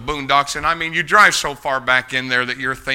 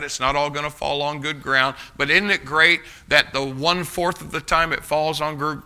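ordinary talk, nothing marked

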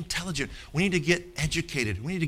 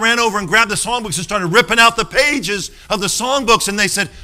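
ordinary talk

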